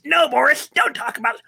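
the nerd hushes Boris, high and alarmed; toy nerd voice. Retold or imitated. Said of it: "a huge toy nerd".

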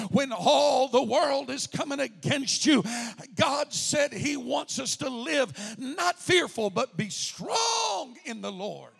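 slow, loud exhortation; preaching shout. Acted. Preacher urging the church to be strong, loud and slow. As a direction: drive it home slowly.

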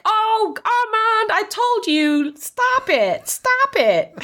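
high-pitched, drawn-out New Jersey protest; exasperated mock New Jersey woman fending him off; New Jersey accent. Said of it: "New Jersey accent".

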